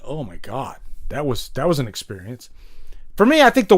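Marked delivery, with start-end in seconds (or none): 0.0-2.5 awed viewer, softer. Retold or imitated. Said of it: "you're just like".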